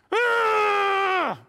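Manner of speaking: very high, loud anguished shout; shrieking cry of the damned; gnashing-teeth cry. Shown as a demonstration. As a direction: let out a tortured yell as a demo. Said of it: "this is what it means".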